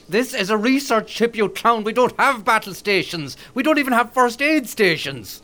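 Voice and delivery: high, indignant outburst; worked-up, high-pitched protest; exasperated rebuke. Acted. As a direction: tell off a deluded captain. Crewman rebukes the captain's order.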